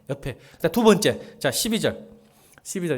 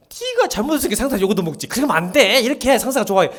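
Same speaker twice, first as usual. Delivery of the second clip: scolding; 6 semitones higher, 6 dB louder than usual. Retold or imitated. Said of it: "상사 편 들어".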